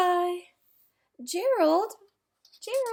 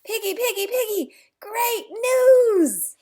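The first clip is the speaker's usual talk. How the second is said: laughing excited call; 8 semitones higher, 8 dB louder than usual. Acted. Elephant runs to tell Piggy the news, laughing call. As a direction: call out with joy.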